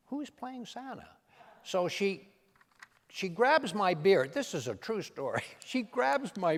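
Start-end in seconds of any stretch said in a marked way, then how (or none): 0.1-1.1 Nancy asking. Retold or imitated. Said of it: "she goes".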